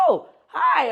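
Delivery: high, loud surprised hello; excited greeting. Retold or imitated. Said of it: "people be like".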